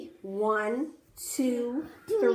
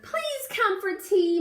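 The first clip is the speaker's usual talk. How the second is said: pleading cry; 6 semitones higher than usual. A narrator's reading. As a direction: call out sweetly to the bees. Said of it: "Miss Spider cried".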